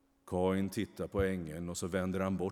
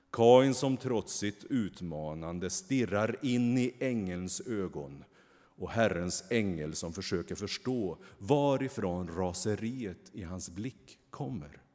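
usual speaking voice

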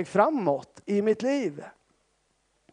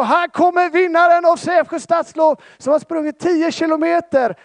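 high, loud mock loudspeaker announcement; imitating race announcer; 11 semitones higher, 9 dB louder than usual. Pastor imitates the race announcer calling the winner, high and loud. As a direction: call the winner out like over the speakers. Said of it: "hör jag liksom hur där de ropar".

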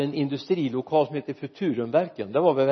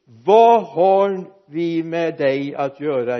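loud, slow, drawn-out cry; the possessed man cries out at Jesus, loud and slow; shouting possessed man; 2 semitones higher, 9 dB louder, much slower than usual. A narrator's reading. Possessed man in the synagogue cries out, loud and slow. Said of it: "och han skrek".

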